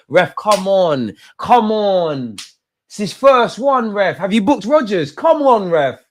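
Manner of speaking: high, slow, loud plea; pleading with the referee. Real, not acted. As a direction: beg the ref, exasperated.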